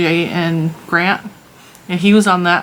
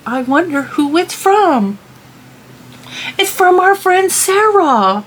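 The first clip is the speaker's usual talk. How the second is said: sing-song voice; 10 semitones higher, slower than usual. Pretend. Playful sing-song reading.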